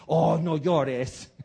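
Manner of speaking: slow, drawn-out mock soothing; dismissive comfort. Preacher mimicking a careless friend, slow mock soothing. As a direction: lightly brush off someone's tears. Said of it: "cosa que tú dices".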